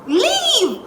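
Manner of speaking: high-pitched retold command; exasperated dismissal. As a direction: send him away in exasperation. Retold order to go away.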